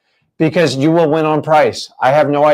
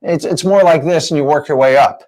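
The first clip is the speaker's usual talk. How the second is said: normal talking voice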